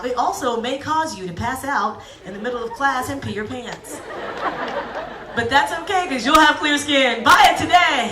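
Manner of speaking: loud, laughing cheesy commercial. Retold or imitated. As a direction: sell it like a hammed-up TV ad. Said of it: "can you imagine a commercial's like".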